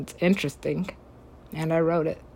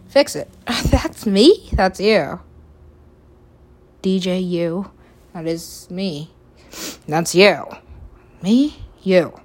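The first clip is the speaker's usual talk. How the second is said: loud bickering back and forth; shouting argument; 7 dB louder than usual. Acted. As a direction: yell it like a quarrel. Shouted argument between two selves.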